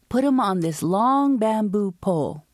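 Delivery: ordinary delivery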